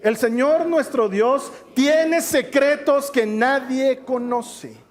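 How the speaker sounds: slow, deliberate scripture reading; measured, drawn-out verse reading; slow reading aloud. Shown as a demonstration. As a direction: read it out slowly for the congregation. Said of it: "Ayúdame a leerlo desde el principio".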